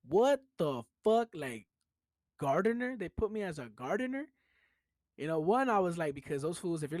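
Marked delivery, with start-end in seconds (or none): 0.1-4.3 indignant disbelief. Retold or imitated. Landscaper retelling seeing his paperwork, indignant disbelief. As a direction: replay being offended by the job title. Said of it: "mad as fuck".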